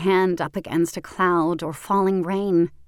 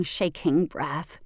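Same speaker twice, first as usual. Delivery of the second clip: ordinary delivery, nothing marked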